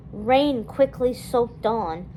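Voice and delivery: usual speaking voice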